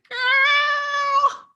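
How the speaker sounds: put-on high-pitched thrilled shriek of Girl; excited squeal. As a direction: squeal it like you can't contain yourself. Reacting to the win.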